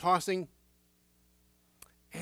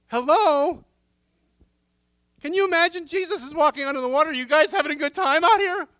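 much higher, louder and fast, a playful breezy greeting; mock cheery Jesus; 11 semitones higher, 12 dB louder, faster than usual. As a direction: call out like a chirpy friend passing by. Pictures Jesus strolling on water.

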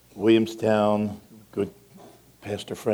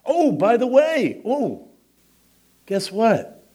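much higher, slow excited lead-in; excited aside; 14 semitones higher, 7 dB louder, much slower than usual. Real, not acted. Grandfather breaking the news to the congregation, excited. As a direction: bubble over with news.

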